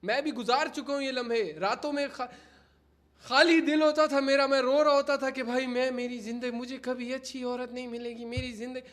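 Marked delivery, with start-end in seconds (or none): none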